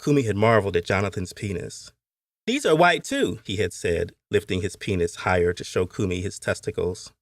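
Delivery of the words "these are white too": put-on high-pitched little boy; boyish character voice. A narrator's reading. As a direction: play a young boy, pitched well up. Boy showing off to playmate.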